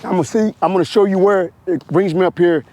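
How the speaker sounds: normal talking voice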